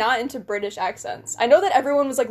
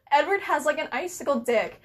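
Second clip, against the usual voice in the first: usual speaking voice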